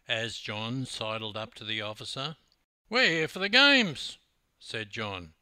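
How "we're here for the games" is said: loud, high, cocky bragging voice; loud cocky larrikin bragging; brash Aussie boast. A narrator's reading. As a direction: swagger up to the officer and announce it. Hammer boasting to customs officer.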